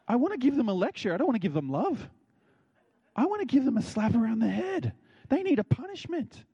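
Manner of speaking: high, heated acted-out rant; cynical. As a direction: rant as the resentful older brother. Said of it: "And I'm so cynical".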